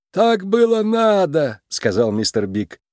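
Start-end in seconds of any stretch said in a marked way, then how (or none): 0.1-1.6 high, slow creaky self-defence; creaky Mister Big. A narrator's reading. Mob boss justifies himself to his daughter, creaky and slow. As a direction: excuse it in a drawn-out little creak. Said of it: "Скрипучий голос мистера Бига звучал властно".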